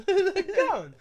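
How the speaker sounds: high, loud mock yell breaking up; laughing echo. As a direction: echo the holler while cracking up. Echoes the yelled impression, laughing.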